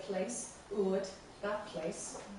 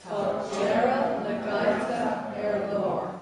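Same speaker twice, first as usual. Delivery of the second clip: loud, measured choral recitation; declaimed for everyone to join in; group recitation lead; 7 dB louder than usual. Shown as a demonstration. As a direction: lead the class loudly through the verse. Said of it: "say, the two lines".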